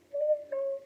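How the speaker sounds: small, very high cat mew; meowing kitty. A narrator's reading. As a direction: mew like a distant little kitten. Unseen kitty meows in story.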